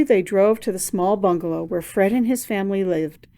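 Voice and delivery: plain reading voice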